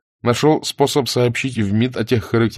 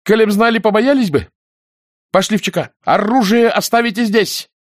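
high, loud scornful command; angry retort; 12 semitones higher, 5 dB louder than usual. A narrator's reading. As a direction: throw his excuse back and order them out. Nina retorts and orders them. (гневно, властно)

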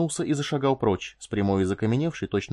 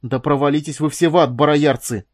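loud, higher curse thrown over the shoulder; angry parting shout; 4 semitones higher, 8 dB louder than usual. A narrator's reading. Wife storming out curses all the Barrayarans, angry shout. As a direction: hurl it back as you storm out. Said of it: "бросив через плечо".

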